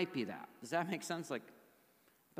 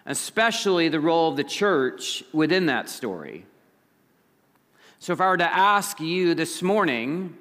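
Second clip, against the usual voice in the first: preaching; 3 semitones higher, 12 dB louder than usual. Acted. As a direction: preach it out to the room. Preaching the main point.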